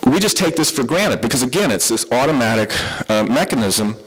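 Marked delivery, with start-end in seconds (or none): none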